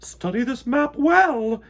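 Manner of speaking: high, loud, slow royal command; queen commanding the bees, loud and slow; queen declaring. A narrator's reading. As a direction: lay down the order to the bees. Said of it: "declared the queen".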